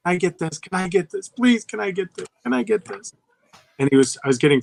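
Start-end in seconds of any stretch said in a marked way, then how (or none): 0.1-3.1 put-on high, pleading kid begging over and over; imitated childish nagging, rising pitch; whining child. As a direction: whine it like a pestering kid, climbing higher. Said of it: "then he's whining the whole store".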